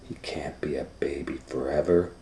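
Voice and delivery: conspiratorial whisper; hushed grown-up advice. Acted. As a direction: lean in and whisper it. Mrs Tweezers whispers advice.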